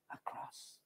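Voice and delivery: a near-whispered "A cross."; hushed, awed reveal. Real, not acted. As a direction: drop to a breath for the reveal. Dramatic reveal in sermon.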